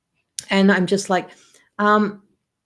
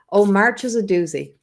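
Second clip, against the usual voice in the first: normal talking voice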